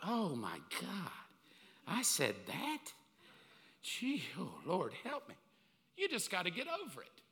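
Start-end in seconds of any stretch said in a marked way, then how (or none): 0.0-5.3 put-on low, slow mortified groan at old sermons; embarrassed self. Preacher cringing at his old sermon clips, low and slow. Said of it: "I'm like".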